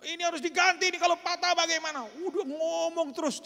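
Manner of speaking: man demanding the car be paid for; ranting man. Retold or imitated. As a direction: rattle on, outraged and shrill. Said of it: "nyerocos bapak itu".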